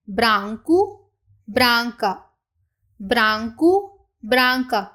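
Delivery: branco with a rising tune, branca falling; model pronunciation. Shown as a demonstration. Teacher models branco and branca, careful and clear. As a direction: say it slowly and clearly for students to copy. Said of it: "पहले हम इनकी pronunciation को देख लेते हैं".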